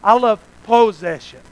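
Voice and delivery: "possession" repeated deliberately, voice eased down. Shown as a demonstration. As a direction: spell out "possession" for the listeners. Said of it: "I'll say it slower".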